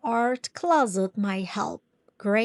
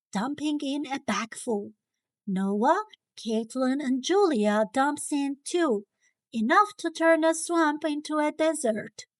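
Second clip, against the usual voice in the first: ordinary delivery, nothing marked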